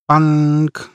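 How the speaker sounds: high, sustained ringing note; held monotone twang; imitated gunshot. A narrator's reading. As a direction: sound out the space gun. Narrator imitates strange gun shot.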